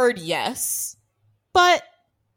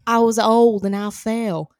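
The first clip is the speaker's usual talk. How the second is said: slow, louder quoted line; quoting the movie; 5 dB louder, slower than usual. Retold or imitated. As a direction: deliver the line with relish. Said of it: "entirely quotable scene".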